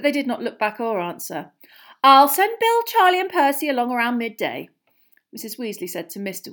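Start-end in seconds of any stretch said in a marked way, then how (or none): 2.0-4.7 high, loud start easing off; raised motherly farewell voice; calling out. Acted. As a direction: announce plans across the yard. Mother calling plans to departing husband.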